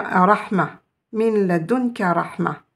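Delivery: rahma said slowly, stopping without the -tan; pausal form. Shown as a demonstration. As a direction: stop on the word: "rahma". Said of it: "on peut s'arrêter, dire".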